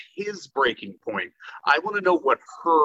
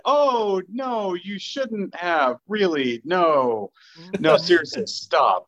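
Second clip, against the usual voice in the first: slow, higher mock-polite protest; feigned gratitude; 4 semitones higher, much slower than usual. Partner receiving unwanted breakfast, drawn-out feigned gratitude. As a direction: drag it out with put-on politeness.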